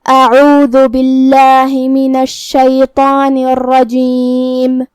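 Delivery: slow melodic tajweed chant; Quran recitation. Shown as a demonstration. Teacher recites the verse in tajweed style. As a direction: draw the verse out in measured recitation.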